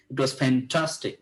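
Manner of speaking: "It was fantastic" drawn out very slowly, punching the "tas" of "fantastic"; enthusiastic stress. Shown as a demonstration. Teacher showing the right way with real enjoyment, stressed. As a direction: sound like you truly enjoyed it, stretching "fantastic". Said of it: "স্ട্রেস দিতে হবে".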